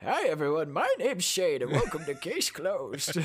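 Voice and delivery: put-on old man croaking a podcast intro. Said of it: "I can't wait until I hear".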